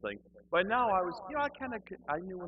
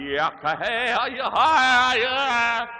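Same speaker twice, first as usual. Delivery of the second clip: imitated loud, high ecstatic wailing babble; praying in tongues; 14 dB louder, much slower than usual. Congregation all around him praying in the Spirit, loud and ecstatic. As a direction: wail nonsense syllables at full volume. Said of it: "they start praying in the Spirit".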